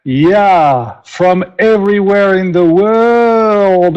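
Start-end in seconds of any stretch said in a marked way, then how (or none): 1.0-4.0 drawn-out, higher rallying call; excited hype. Real, not acted. Host greeting over two hundred online guests, excited. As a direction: stretch it out like a proud announcer. Cheering a big international audience.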